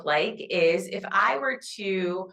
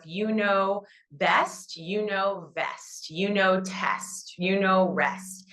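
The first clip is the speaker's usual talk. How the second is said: slow deliberate listing; slower than usual. Shown as a demonstration. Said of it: "listing rhyming words slowly".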